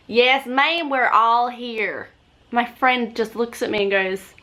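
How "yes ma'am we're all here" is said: higher, drawn-out Southern US reply; copied Southern accent. Retold or imitated. Passenger answering bus lady in copied Southern accent. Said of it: "And I'm like".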